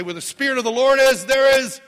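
loud, high preacher call to the crowd; preacher leading a call and response; preaching call-out. Acted. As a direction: fire up the congregation. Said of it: "if I get up and say".

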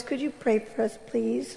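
softly, meekly asking; a hint of a humble husband's quiet plea; humble request. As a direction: ask gently, almost under the breath. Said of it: "he was so humble".

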